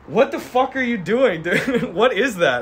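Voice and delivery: can't hold the cop voice for laughing; alarmed cop breaking into laughter; laughing mock alarm. Cops alarmed, speaker laughing.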